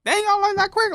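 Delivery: high, loud retold astonishment; mock amazement. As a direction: exclaim it high, playing up the surprise. Retelling his reaction to coworkers.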